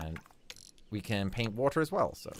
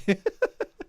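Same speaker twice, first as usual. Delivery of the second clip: high giggle; 11 semitones higher, 6 dB louder than usual. Real, not acted. Laughing at the crazy mountain.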